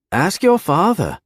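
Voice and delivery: put-on high, goading young man voice; challenging bully voice. A narrator's reading. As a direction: dare him to ask. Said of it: "Benedict challenged".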